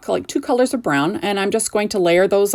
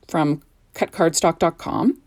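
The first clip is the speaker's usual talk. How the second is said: ordinary delivery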